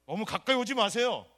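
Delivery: loud, slow and high keep-back cry; hammed-up don't come near; mock keep-away shout. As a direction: push them away with the voice. Keeping coworkers away.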